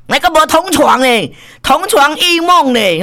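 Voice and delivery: high-pitched emphatic exclamation; exclaiming. Real, not acted. Exclaims over the shared-bed item.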